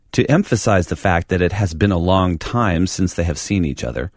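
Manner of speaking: normal talking voice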